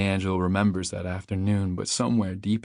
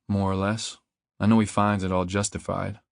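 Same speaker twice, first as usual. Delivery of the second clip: usual speaking voice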